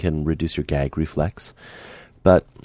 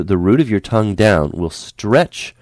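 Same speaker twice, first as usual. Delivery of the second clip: ordinary talk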